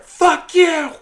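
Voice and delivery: high, loud mimicked yell; explosive mock shriek of anger; furious. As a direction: imitate an enraged woman cursing. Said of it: "kill in her eyes".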